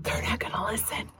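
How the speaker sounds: soft whispered aside. Real, not acted.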